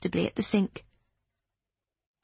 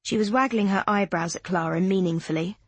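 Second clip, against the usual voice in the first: ordinary delivery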